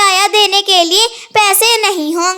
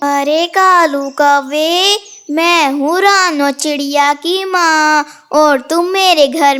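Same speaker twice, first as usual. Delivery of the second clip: stretched-out spooky threat; pretend ghost; 3 semitones lower than usual. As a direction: act a mother's ghost to scare a sleeper. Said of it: "आवाजें लगाना कि तुम भूत हो".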